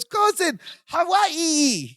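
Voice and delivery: high, excited Balki voice with Hawaii squealed. Retold or imitated. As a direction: shriek Cousin, Hawaii like an overjoyed foreigner. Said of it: "He goes".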